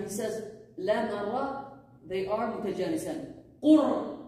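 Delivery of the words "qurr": Qurr said loudly with a rolled r. Shown as a demonstration. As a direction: roll the r in Qurr strongly. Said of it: "this is how you say it".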